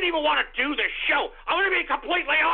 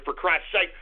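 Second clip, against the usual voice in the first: ordinary talk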